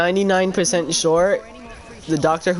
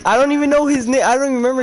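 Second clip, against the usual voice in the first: much higher and louder; excited protest; 8 semitones higher, 4 dB louder, much faster than usual. Real, not acted. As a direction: insist with a high, loud protest.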